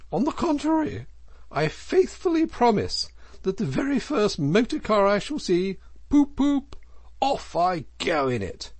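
high, loud, gleeful vow with hooted "poop, poop"; boastful Toad, excitedly defiant; emphatic. A narrator's reading. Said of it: "replied the Toad emphatically".

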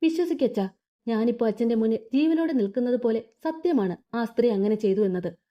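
usual speaking voice

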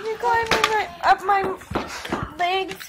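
high, cooing mother's voice. Real, not acted. Mom to baby climbing up her legs, playful baby talk.

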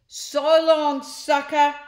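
high, loud jeering yell; mocking shout. The Gluttons jeering at Ned as they drive off, loud. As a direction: jeer it as you speed off. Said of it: "the pair shouted".

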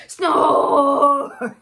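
loud snore breaking into a laugh. Acted. Reader snoring for Piggy, loud and laughing. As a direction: belt a snore and crack up.

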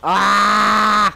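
sudden loud high yelp; startled yelp. Real, not acted. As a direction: jump at the enemy behind you. Startled by enemy behind.

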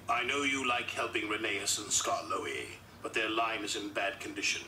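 usual speaking voice

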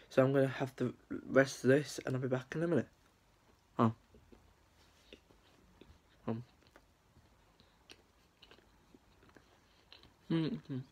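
chewing while talking; talking around food; mouth full. Real, not acted. Seen 3: Hand to mouth after cake, cheeks puffed while speaking.

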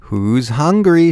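high, drawn-out chirp; sing-song grandma calling to dinner; cheerful chirp. A narrator's reading. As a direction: chirp it loudly, stretching it out. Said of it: "she chirped".

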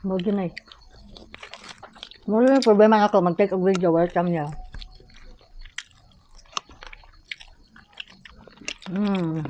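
chewing while talking; talking between bites; mouth full. Real, not acted. Seen 1: Pink-top speaker bites red seafood, then talks while chewing.

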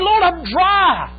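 slow, high, loud lament; retold weeping prayer. Preacher voicing Wilkerson weeping to the Lord, slow. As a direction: cry it out to God slowly. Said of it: "weeping and said".

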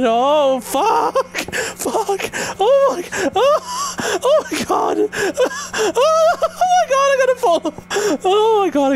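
high panicked screams on the ride; screaming while falling. Real, not acted. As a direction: shriek in real terror. Screaming on VR roller coaster.

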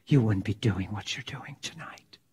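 low hushed whisper. Retold or imitated. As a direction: whisper it close and earnest. Pleading with the prostitute, whispering.

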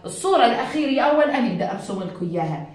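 ordinary talk

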